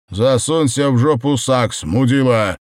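loud, insulting outcry; angry shout. A narrator's reading. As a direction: yell the insult at him. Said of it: "выкрикнул Андрюха".

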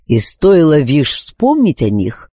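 usual speaking voice

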